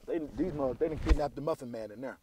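chewing while talking; spraying crumbs mid-sentence; mouth full. Real, not acted. Seen 2: Bites muffin at 4.9, crumbs spray while talking after.

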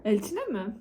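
normal talking voice

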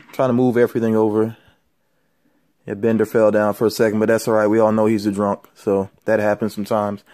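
normal talking voice

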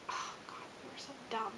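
a sheepish whisper to yourself; whispered aside. Real, not acted. Embarrassed aside after the story.

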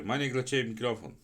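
ordinary delivery

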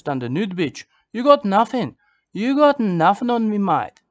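loud, high jeering boast; mock taunt. Taunting his sexual competitors, loud mock boast. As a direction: gloat at your rivals. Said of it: "to my sexual competitors".

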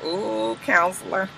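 hushed, drawn-out coo; impressed ooh. Acted. Recapper reacting to the counselor's ultimatum, softly impressed.

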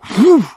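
high, loud whooshing sound; kick whoosh. Retold or imitated. The horse's kick whooshing past dad's face, loud and high. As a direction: whoosh like a hoof swinging past. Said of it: "he goes".

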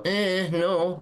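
high-pitched balking "no"; mock dismissive refusal. Activist voicing little Britain refusing the European bloc, high dismissive refusal. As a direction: balk in a put-on high voice.

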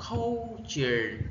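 "culture" as CUL-ture, CUL held long; first-syllable stress. Shown as a demonstration. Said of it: "Nhấn âm, âm một". (nhấn âm một của "culture")

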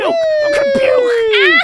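high, loud, slow scream; drawn-out scream. Real, not acted. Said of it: "repeated, screamed long".

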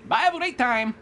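high put-on send-off voice; see them off in a silly high voice; mocking farewell. Mock farewell to dying player.

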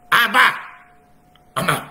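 loud, high call to the Father; crying out. Retold or imitated. Heart crying out to the Father, loud and high. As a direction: cry out like a child calling its dad.

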